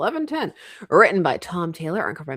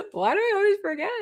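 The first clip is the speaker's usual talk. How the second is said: high squeak; 13 semitones higher, faster than usual. Real, not acted. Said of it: "Oh, I always forget".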